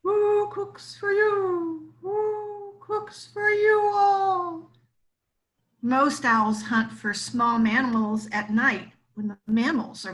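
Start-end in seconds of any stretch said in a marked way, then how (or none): 0.0-4.7 high, slow hooting owl voice; owl call. A narrator's reading. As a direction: draw out each word like an owl hooting. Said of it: "This deep voice of a barred owl".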